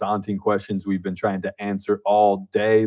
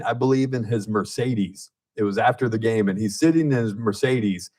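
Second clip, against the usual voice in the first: ordinary talk, nothing marked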